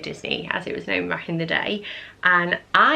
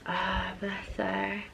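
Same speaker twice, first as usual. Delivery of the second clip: much softer, slow and tender; tender murmur; 11 dB quieter, slower than usual. Real, not acted. Vlogger seeing her pregnant mum in a photo, softly tender. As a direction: melt a little as you say it.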